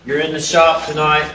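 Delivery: ordinary talk, nothing marked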